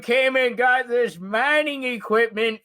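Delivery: put-on high old-man character voice. Old man chatting to visitors about mining equipment, high put-on voice.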